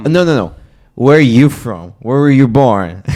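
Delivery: loud, high-pitched pressing for an answer; mock insistent questioning. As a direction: imitate a pushy stranger, stressing YOU. Mimics insistent questioner pressing for origin.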